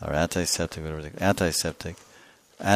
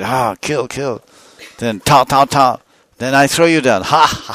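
loud, higher battle cries; hammed-up fighter working into a frenzy; fired up; 5 semitones higher, 8 dB louder than usual. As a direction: attack with mock bloodlust, pitch raised. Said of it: "now I'm really getting fired up".

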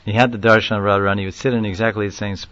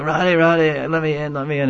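desperate plea; 6 semitones higher than usual. Retold or imitated. Pujari at locked gate.

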